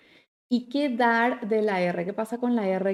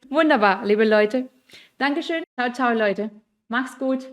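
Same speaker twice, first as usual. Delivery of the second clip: bright, loud goodbye to the students; cheerful farewell; 3 semitones higher, 6 dB louder than usual. Real, not acted. Teacher says a cheery goodbye to the class, loud and lively. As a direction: wave them off with energy.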